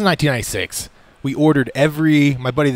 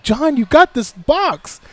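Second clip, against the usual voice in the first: mock parent; 12 semitones higher, much slower than usual. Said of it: "and they're like".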